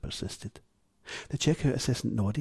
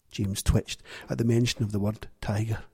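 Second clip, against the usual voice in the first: ordinary delivery, nothing marked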